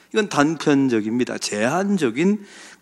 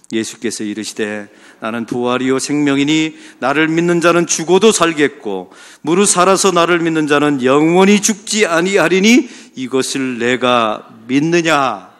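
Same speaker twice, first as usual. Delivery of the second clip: scripture reading; 5 dB louder than usual. Acted. Congregation reads scripture together.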